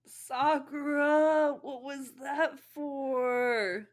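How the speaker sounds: high, slow whimpering complaint; high slow whiny protest; whiny Naruto. Acted. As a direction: whine like it really hurts. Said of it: "he held his head rocking".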